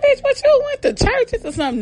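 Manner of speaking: very high incredulous question; hammed-up squeaky disbelief; mock disbelief. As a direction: act amazed, voice shooting way up. Acted disbelieving question to sister.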